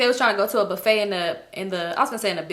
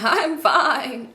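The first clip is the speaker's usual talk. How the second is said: voice breaking; 5 semitones higher than usual. Retold or imitated. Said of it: "Voice breaking".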